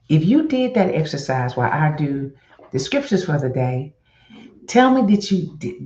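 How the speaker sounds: usual speaking voice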